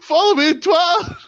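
high, loud plug shouted out. Acted. Wrestler shouting his plug to listeners, loud and high. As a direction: bellow the plug at the audience.

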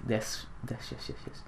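breathless whispered yeses; whispered glee. Real, not acted. Gamer landing a jump, whispered triumph. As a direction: hiss it under your breath in triumph.

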